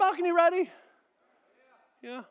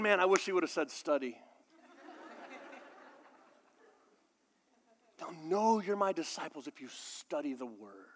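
grumbling skeptic; 6 semitones lower, 9 dB quieter than usual. Retold or imitated. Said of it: "y'all were thinking".

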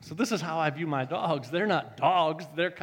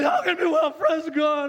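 higher, louder, anguished protest; arguing shout; 12 semitones higher, 6 dB louder than usual. Retold or imitated. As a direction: shout back in desperate argument. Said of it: "I began to argue with that spirit".

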